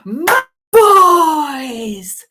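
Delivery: booming, delighted cry of welcome; excited welcome. Retold or imitated. Father greeting his sons, loud and overjoyed. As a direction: throw your arms open and shout with joy. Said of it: "opened his arms and goes".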